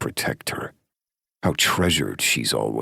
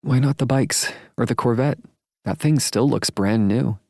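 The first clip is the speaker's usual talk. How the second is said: higher, louder curious woman; woman's voice; 11 semitones higher, 4 dB louder than usual. A narrator's reading. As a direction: ask it eagerly, pitched up. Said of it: "Shelley says, tapping her fingernails".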